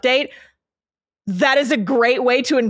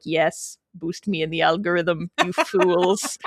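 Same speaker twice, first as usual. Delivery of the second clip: mustache twirling villain; 4 semitones lower than usual. Pretend. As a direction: gloat like a cartoon villain. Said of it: "My evil laugh, I'm twirling my mustache".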